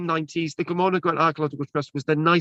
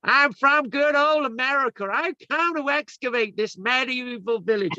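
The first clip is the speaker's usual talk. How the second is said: slow, higher put-on American accent, hammed up; mock American accent; 7 semitones higher than usual. Archaeologist imitating American volunteers, mock American accent. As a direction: drawl it like a proud American visitor. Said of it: "you would always hear an American accent".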